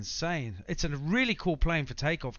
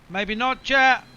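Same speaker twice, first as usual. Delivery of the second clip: rising alarm, louder; 9 semitones higher, 11 dB louder, slower than usual. Real, not acted. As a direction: panic creeping in. Plane goes haywire.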